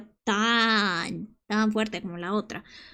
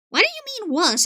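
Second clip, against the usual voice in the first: indignant; 9 semitones higher, 4 dB louder than usual. Acted. Indignant over Scooby-Doo.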